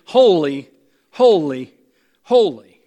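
each "Holy" punched loud, falling, with a pause after; emphatic repetition. Shown as a demonstration. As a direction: hit every "holy" hard and separately. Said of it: "You see the repetition there".

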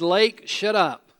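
loud, slow order to the storm; slow stern command. Pretend. Jesus to the lake, a slow loud command. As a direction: command the lake, deliberate and firm.

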